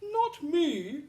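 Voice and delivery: lightly voiced crying lion. A narrator's reading. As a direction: cry it out as the sad lion. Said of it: "cried the lion".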